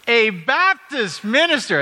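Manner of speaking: incredulous retold shout; shouting in disbelief. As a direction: announce it to the whole airport. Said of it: "at the top of her voice again".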